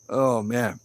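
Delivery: ordinary talk, nothing marked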